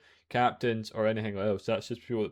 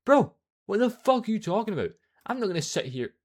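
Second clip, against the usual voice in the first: louder, higher, fed-up snapping; exasperated outburst; 12 semitones higher, 9 dB louder than usual. Real, not acted. Fed up with the bragging commenter, loud exasperation. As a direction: call out the nonsense, fed up.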